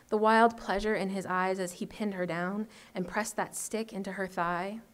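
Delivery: usual speaking voice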